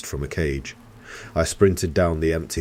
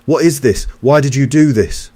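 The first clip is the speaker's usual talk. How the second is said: much higher and louder desperate yell; shouting; 10 semitones higher, 11 dB louder than usual. A narrator's reading. Cooper demands answers from his boss, desperate yell. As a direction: yell the questions at him.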